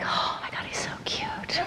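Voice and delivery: breathy, unvoiced girlish crush whisper; hushed, secret swoon. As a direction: whisper it to yourself, smitten. Swooning thought as he walks in.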